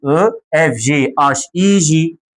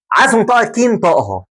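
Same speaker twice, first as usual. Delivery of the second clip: ordinary talk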